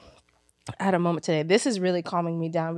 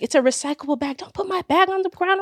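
dogging her; 10 semitones higher than usual. Retold or imitated. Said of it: "dogging her".

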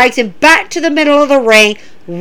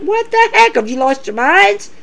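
surprised; 6 semitones higher than usual. Real, not acted. Reacting to wrestlers' disrespect.

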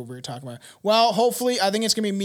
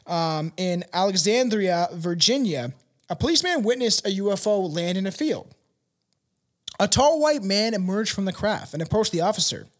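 usual speaking voice

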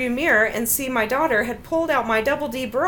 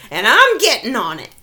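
put-on louder kid yell; playful yelled reply; mock child yell; 6 dB louder than usual. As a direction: shout it like a defiant kid. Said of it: "yelled at the top of his lungs".

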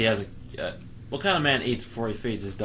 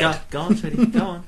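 sweet coaxing pet talk; cooing to a dog; 6 semitones higher, 8 dB louder than usual. Acted. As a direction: coax it like to a pet.

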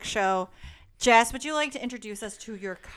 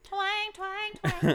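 twang imitation; 11 semitones higher, much slower than usual. Imitates juice harp.

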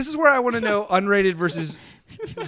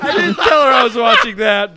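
laughing shout; 3 semitones higher, 8 dB louder than usual. Real, not acted.

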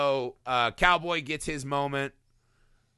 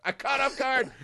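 high, laughing fake whimper; giggling mock complaint; laughing mock whine; 11 semitones higher than usual. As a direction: imitate a startled son through laughter. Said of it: "And Derek's like".

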